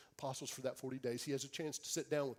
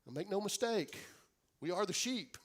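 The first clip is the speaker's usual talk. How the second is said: normal talking voice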